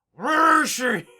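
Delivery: loud, high yell for the missing prisoner; shouting marshal. Retold or imitated. Marshal waking up and yelling for his prisoner, loud shout. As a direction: bellow it out, searching frantically.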